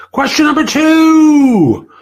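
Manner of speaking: drawn-out high, loud call with a held last word; announcer call. Real, not acted. Host announcing the next question to viewers, called out loudly. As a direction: sing out the heading, stretching the final word.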